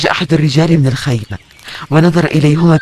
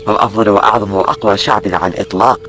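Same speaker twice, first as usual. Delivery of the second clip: lower, boastful soldier voice; low proud legionary, baffled; proud Roman soldier; 5 semitones lower than usual. Acted. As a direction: puff up with Roman pride.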